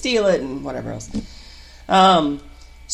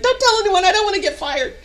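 higher, laughing nervous plea; 14 semitones higher, 4 dB louder than usual. Retold or imitated. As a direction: beg him with a giddy laugh. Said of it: "I was like".

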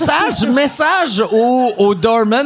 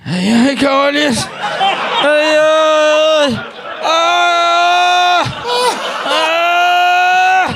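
loud pained yelps, higher and louder; stubbed toe; 8 semitones higher, 4 dB louder, much slower than usual. Retold or imitated. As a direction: yelp in pain after hitting your head. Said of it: "t'entendais juste".

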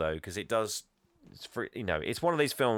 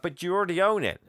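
high-pitched mock bafflement; retold incredulous protest; lightly acted confusion, pitched up; puzzled objection; 8 semitones higher, 4 dB louder, faster than usual.

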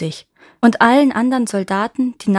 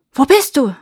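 loud, raised searching call; louder, higher shouted question; shepherds calling out; 5 semitones higher, 7 dB louder than usual. A narrator's reading. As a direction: call out to someone hidden. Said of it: "riefen die Hirten".

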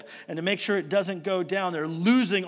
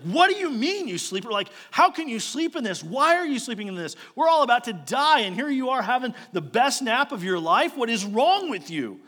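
higher, louder exasperated scolding; angry captain; 6 semitones higher, 4 dB louder than usual. Retold or imitated. Captain scolding sleeping Jonah mid-storm, loud and exasperated. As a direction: shake a sleeper awake with disbelief. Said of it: "He's like".

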